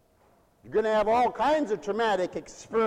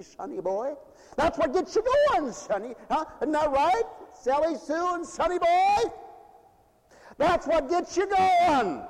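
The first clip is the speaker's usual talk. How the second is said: high, louder singsong teasing; teasing parent; 10 semitones higher, 3 dB louder than usual. Retold or imitated. Preacher teases children as Sally Sue and sonny boy. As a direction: tease the kids with a grin.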